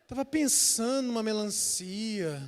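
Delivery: put-on soft, sly little hint from a child; son's hinting voice. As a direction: drop the voice to a coy hinting murmur. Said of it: "Ele fala assim". (voz mansa de criança dando indireta)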